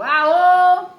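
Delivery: high, loud yell; shout. Acted. Mole yelling again at grandma. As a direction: let out a big cry. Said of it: "soltou outro grito".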